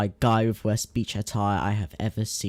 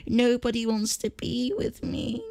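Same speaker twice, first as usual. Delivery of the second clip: high, mocking whine; high whiny mock of a complaint post; 12 semitones higher, 3 dB quieter than usual. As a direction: mimic a self-pitying girl. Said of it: "a post about her complaining".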